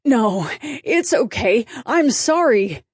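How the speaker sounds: mildly flustered, uneven pitch; hasty reassurance rising into apology; stammered apology. A narrator's reading. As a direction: apologize in a lightly nervous fluster. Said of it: "the guy stammered".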